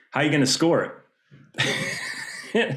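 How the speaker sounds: slow, laughing mock question; laughing retelling. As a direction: chuckle through the principal's line.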